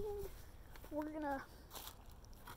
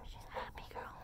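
soft whisper; whispered coo; 12 dB quieter, much faster than usual. Real, not acted. Petting the happy dog, soft whisper. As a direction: whisper it fondly.

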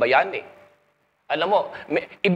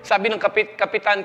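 normal talking voice

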